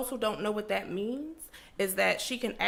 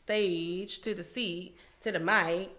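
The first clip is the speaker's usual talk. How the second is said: slow announcer intro; slower than usual. Acted. Slow drawn-out guest introduction.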